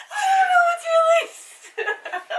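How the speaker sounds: high, slow, strained laughing plea; being tickled. Real, not acted. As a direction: squeal it out while tickled.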